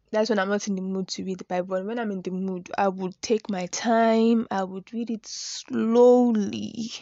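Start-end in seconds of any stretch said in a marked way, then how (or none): 4.5-6.9 slowly drawn out, each word stretched; slowly stressed. Shown as a demonstration. Telling viewers how she reads the Bible, slowly drawn out. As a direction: lean on slowly and let it crawl. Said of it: "Emphasis on the slowly".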